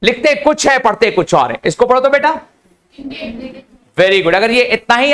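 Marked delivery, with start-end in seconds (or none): none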